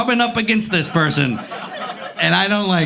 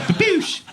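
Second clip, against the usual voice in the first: mock impact noise; 10 semitones higher than usual. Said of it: "It's like".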